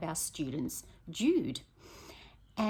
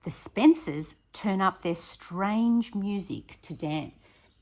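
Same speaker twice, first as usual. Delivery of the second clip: louder, lively read-aloud; storybook reading; 6 dB louder, slower than usual. A narrator's reading. Teacher reading the noisy neighbour page to her class. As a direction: read it out with relish.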